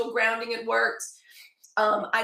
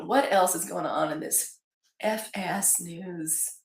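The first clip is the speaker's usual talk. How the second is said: usual speaking voice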